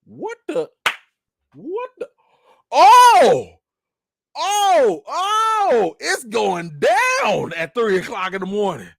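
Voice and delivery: high, rising loud astonishment; shocked exclamation. Retold or imitated. Retelling shock at noise from window. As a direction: react like you can't believe it. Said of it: "and I'm like".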